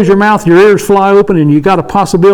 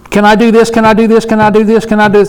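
higher-pitched, insistent repeated pleading; pestering little kid; 4 semitones higher than usual. Retold or imitated. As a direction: imitate a child nagging to wear a parent down. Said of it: "Like a little kid".

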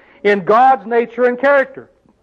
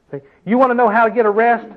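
usual speaking voice

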